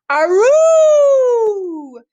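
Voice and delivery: high, loud wolf howl. Acted. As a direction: throw your head back and howl. Reading wolf sound word.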